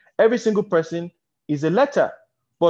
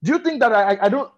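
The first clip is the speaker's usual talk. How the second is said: mock shout; 7 semitones higher, faster than usual. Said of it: "Tony now shouts".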